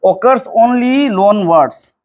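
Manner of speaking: very slow, word by word, for students to write down; slow dictation. Shown as a demonstration. Teacher dictating a note to the class, very slowly. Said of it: "ꯑꯗꯨꯅ ꯃꯁꯤꯁꯦ".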